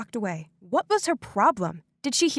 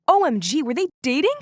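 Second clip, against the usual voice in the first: shocked excitement; 4 semitones higher, 5 dB louder than usual. A narrator's reading. Narrator shocked at dad dating Linda.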